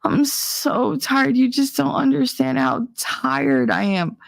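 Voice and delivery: high, slow whining complaint; mock the spoiled girl's whine; whiny young woman. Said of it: "these twenty-two-year-old chicks be like".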